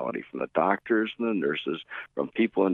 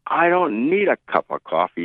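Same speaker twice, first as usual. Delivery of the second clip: loud, higher irate demand; 10 semitones higher, 6 dB louder than usual. Retold or imitated. Flustered guest refuses coffee from desk clerk, irate and loud. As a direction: snap back at the clerk, irate. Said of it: "he said in a very irate voice".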